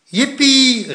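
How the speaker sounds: high, loud whoop; excited cheer. A narrator's reading. Plop cheering at grandma's breakfast plan, excited cry. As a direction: cheer out in delight. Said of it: "riep Plop".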